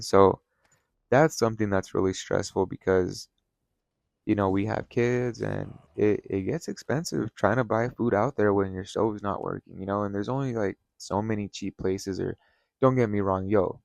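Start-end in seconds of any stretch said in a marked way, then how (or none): none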